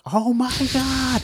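slow awed squeal after the handshake; star-struck. Retold or imitated. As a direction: stretch it out, giddy with disbelief. Star-struck after meeting idol.

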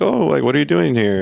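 a light mock of a surprised, friendly singer. Quoting [name] in the story.

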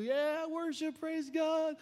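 high-pitched put-on praise; mock worship zeal. Back-row member praising because told to, mock enthusiastic.

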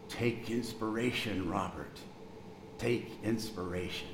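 put-on a nurse's gentle French accent, unhurried; thick French accent. Male nurse comforting a sick boy, soft French accent. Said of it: "said in a very thick French accent".

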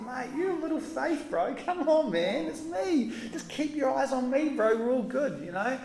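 lightly voiced chummy Jesus; matey reassurance. Shown as a demonstration. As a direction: reassure your mate gently. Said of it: "Jesus never talked like that".

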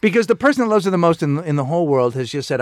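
usual speaking voice

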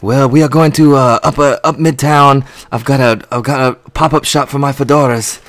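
playful fake Correa accent; mock Carlos Correa accent. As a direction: put on a Correa accent, hammed up. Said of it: "this accent is racist".

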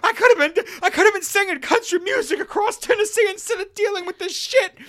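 very high, loud, laughing wail; hysterical mom. Retold or imitated. Mom regretting her life, very high loud wail. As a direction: wail it like a frantic southern mom. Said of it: "your mom's like".

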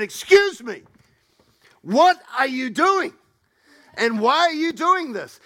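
loud, slow and high over the crowd; loud call for calm to the yelling passengers; calling out firmly. Retold or imitated. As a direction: cut through the crowd, loud and deliberate. Addressing the shouting crowd.

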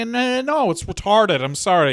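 ordinary talk

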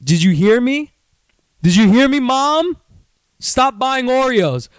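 loud, high yell to Mom; calling out. Pretend. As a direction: holler a complaint to another room.

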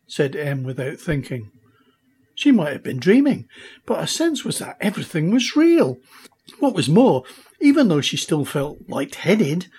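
usual speaking voice

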